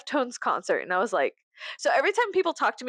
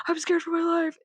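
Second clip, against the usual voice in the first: higher, hammed-up scared voice; mock fright; 7 semitones higher than usual. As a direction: imitate a frightened guy, pitched up. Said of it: "so he was like".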